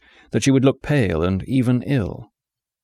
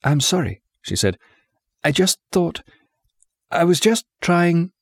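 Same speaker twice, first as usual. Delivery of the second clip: a higher, hurt voice close to tears; hurt, near tears; 8 semitones higher than usual. A narrator's reading. A hurt young woman apologising to the bitter patient, close to tears. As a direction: hold back the tears as she apologises.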